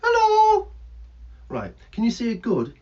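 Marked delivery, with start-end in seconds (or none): 0.0-0.7 high, loud squawked hello; cheeky bird hello. Acted.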